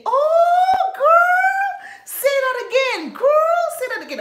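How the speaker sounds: very high, loud delighted shout; imitating thrilled classmate. Classmate thrilled by Yoruba, very high and loud. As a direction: squeal for an encore. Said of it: "Báwo ni?".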